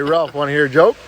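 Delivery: usual speaking voice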